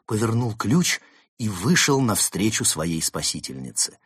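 ordinary delivery

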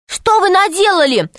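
sharp high cry of alarm; shouting. A narrator's reading. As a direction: cry out in dismay. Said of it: "крикнула Алиса".

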